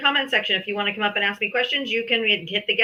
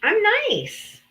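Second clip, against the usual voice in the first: mock sweet; 12 semitones higher than usual. Repeat of sing-song tease.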